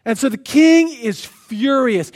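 ordinary talk, nothing marked

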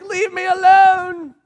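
high, loud, drawn-out mock yell; exasperated holler, stretched slow; fed-up parent. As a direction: cry out like a parent at the end of their rope. Parent bothered incessantly snaps.

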